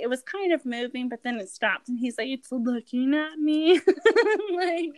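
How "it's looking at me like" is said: laughing. Retold or imitated. Fiance's alarm retold, laughing.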